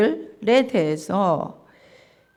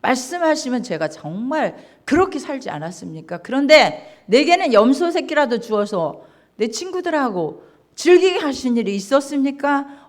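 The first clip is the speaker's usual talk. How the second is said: rising, louder angry complaint; protesting son; 5 dB louder, faster than usual. Acted. Elder son angrily protesting to his father, building up. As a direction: argue back, building to a near shout. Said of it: "이렇게 따지는 거예요".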